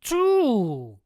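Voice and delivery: very loud, high, drawn-out count; shout. A narrator's reading. Satan whips again, loud shout. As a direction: shout out the next lash. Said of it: "raises his arm again and shouts".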